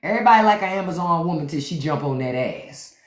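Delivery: ordinary delivery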